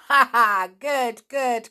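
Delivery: loud laughing praise; hearty laughing good good; cried with a laugh. A narrator's reading. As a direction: laugh then cheer. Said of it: "cried Rossi".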